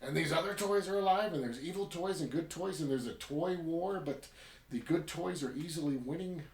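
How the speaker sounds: imitating a thrilled viewer; gushing excitement. Viewer reacting to the toy war, gushing excitedly. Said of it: "and you're like".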